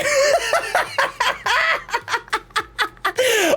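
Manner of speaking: long, high burst of laughter. Real, not acted. Laughing at Hollow's rude moment, high long laugh.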